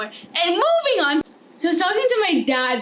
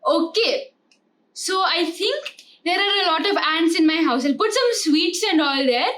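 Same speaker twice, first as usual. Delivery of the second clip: mock Indian seller hawking ants, higher and rising; playful Indian accent, pitched up on the prices; 5 semitones higher than usual. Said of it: "let's think about Indians".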